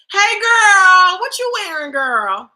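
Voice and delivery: high, loud, gushing fake friend; mock girlfriend greeting.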